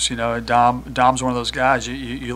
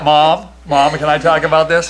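playful loud call to his mom, pitched up; 4 semitones higher, 9 dB louder than usual. Real, not acted. Jokingly calls out to his mother.

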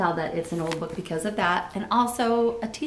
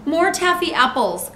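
high, loud call for more; 8 semitones higher, 5 dB louder than usual. A narrator's reading. Mister Rogers wanting more taffy apples, high loud call. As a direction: call across to the others. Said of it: "called Mister Rogers".

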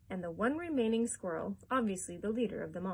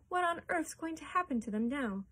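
worried, higher-pitched question; crying out; 7 semitones higher than usual. A narrator's reading. Boy wondering about the fallen family, worried cry. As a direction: ask anxiously, pitch raised. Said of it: "cried Charlie".

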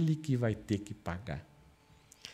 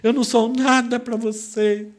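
high, loud aggrieved complaint; wife reproaching Samson, high loud whine; wife's complaint; 8 semitones higher, 8 dB louder than usual. Acted. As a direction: voice the wounded bride, pitched way up. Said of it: "E ela fala".

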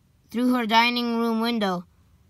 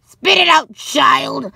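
loud, higher-pitched impatient order; scolding; 3 semitones higher, 8 dB louder, slower than usual. A narrator's reading. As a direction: scold the child to hurry up. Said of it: "scolded the witch lady".

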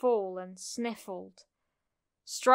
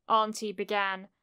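plain reading voice

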